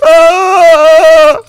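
high, loud scream; anguished wail. Acted. Player grieving his sheep, anguished high wail. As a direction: wail out loudly at high pitch. Wails over the dead sheep.

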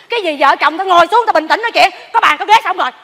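normal talking voice

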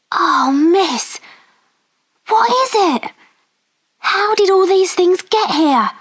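put-on high-pitched, wonderstruck girl's questions; amazed Becky. A narrator's reading. Becky sees the transformed room, amazed. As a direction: voice an astonished Becky, pitched well up.

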